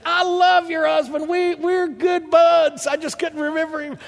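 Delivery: high, loud, over-the-top excited shouting; gushing greeting. Retold or imitated. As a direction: gush loudly like greeting a long-lost buddy. Acting out effusive fake-recognition greeting.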